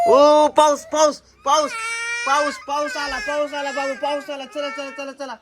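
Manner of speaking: high, loud pretend rain alarm; mock alarm. Mother faking alarm about rain to wake kids, high and loud. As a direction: shout it like the sky is falling.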